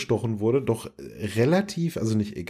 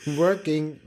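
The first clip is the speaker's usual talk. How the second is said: higher imitated machine voice; computer voice; 4 semitones higher than usual. Co-host repeating a favourite computer phrase, computer voice. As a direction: play the computer, pitched up. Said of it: "dann sagt der Computer".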